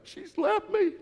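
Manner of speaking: high, whiny imitation of a heartbroken son; mock crying son. Son telling his dad she left, in a high mock wail. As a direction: play the son wailing that she left him. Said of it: "and he was crying".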